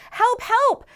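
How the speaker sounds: high, loud cry for help from the hole; calling for help. Acted. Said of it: "so they call out".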